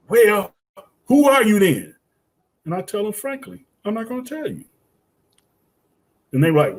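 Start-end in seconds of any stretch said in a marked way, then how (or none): 0.1-1.9 raised, louder mock challenge; imitating frustrated students. Students turning the question back on teacher, mock exasperation. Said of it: "they get all frustrated". 3.1-4.6 high and hushed retort; coy refusal. Retold or imitated. Teacher refusing to give students the answer, soft high refusal.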